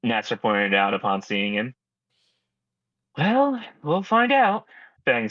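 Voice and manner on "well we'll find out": high character voice. Acted.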